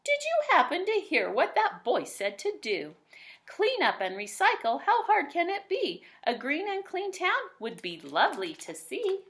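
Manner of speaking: a hint of an eager townswoman; lightly voiced Missus Mooncock. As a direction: gossip brightly to a friend. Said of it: "Missus Mooncock explained to her friend".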